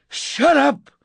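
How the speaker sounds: high, loud outburst; angry scream. A narrator's reading. Narrator at the barricaded door, angry scream. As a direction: scream to silence her.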